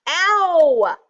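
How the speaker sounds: loud, high yelp of pain; sharp high "ow"; cried out. A narrator's reading. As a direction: yelp as if just tripped. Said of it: "cried Rabbit".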